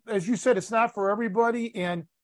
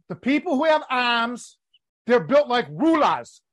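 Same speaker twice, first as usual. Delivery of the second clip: higher mock Boston accent, R's dropped; mimicking Boston accent; 4 semitones higher than usual. Student showing what he learned from teacher, mimicked Boston accent. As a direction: parody a New England speaker's vowels. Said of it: "Let me see if I learn".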